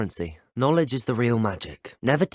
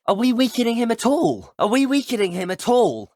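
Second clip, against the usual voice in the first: high, loud urgent call; battle shout; 11 semitones higher, 4 dB louder than usual. Acted. Asking an ally whether he's weakening, high urgent shout. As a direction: shout it over the fight. Calling out during a fight.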